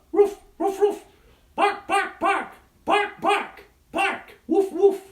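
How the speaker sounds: high, loud yappy barking; dog barks. Acted. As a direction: bark like a small playful dog. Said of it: "Look, it's a dog".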